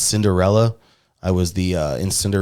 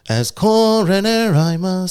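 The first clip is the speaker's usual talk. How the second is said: very high, slow munchkin squeak; Munchkin coroner; 10 semitones higher, slower than usual. Retold or imitated. Showing his Munchkin Land part, squeaky coroner. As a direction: pipe it up like a tiny Munchkin. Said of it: "the corner of Munchkin Land".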